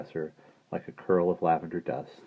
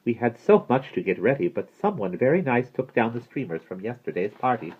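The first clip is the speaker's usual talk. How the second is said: raised, lively character voice; fussy lady; 6 semitones higher, 4 dB louder than usual. A narrator's reading. Miss Christabel tells Maggie of preparations, fussy lifted voice. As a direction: chatter busily about preparations.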